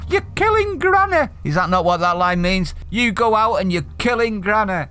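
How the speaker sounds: normal talking voice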